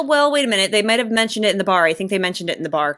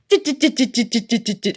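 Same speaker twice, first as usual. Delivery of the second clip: rapid staccato "dit-dit-dit"; ticking run of short electronic-style blips; imitated TV sound effect; 4 semitones higher than usual. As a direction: mimic a cheesy bionic action sound. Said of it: "it goes".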